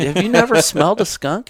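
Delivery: high-pitched amazed question; acted-out disbelief, voice way up; retold incredulity. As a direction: replay your astonishment at his ignorance.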